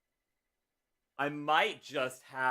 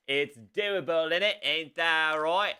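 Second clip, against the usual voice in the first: put-on loud, drawn-out British accent, pitched high; playful British turn, higher and louder; 6 semitones higher, 6 dB louder than usual. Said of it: "I don't know why I'm British".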